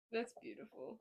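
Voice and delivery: ordinary talk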